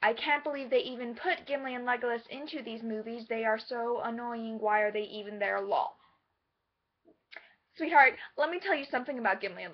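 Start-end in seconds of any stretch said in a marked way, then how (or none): 0.0-6.0 low, droning whine of a commenter; mock internet complaint. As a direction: read it like a dismissive online comment. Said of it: "I saw something along the lines of".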